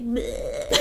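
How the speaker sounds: mock disgusted bleh. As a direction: blurt a breathy bleh. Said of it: "her bleh voice".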